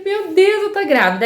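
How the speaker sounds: high-pitched burst of excited disbelief; retold joyful exclamation. Retold joy once pregnancy is confirmed. (exclamação aguda de alegria)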